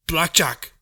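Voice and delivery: shouted name from afar; shouting. Acted. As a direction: call her name loudly. Someone shouting her name.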